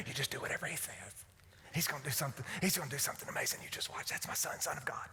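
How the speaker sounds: low, hushed proud-mother aside; imagined confiding Mary. Acted. Mary confides in the servants about her son, hushed and assured. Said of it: "I can just imagine".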